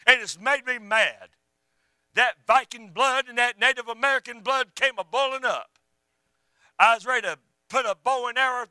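high, loud pulpit delivery; fired-up sermon voice, ringing out; full-force preaching. Real, not acted.

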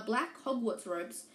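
ordinary delivery, nothing marked

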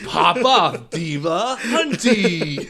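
slow, booming hype announcer; drag brunch ad. Acted. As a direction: bellow it with laughing hype.

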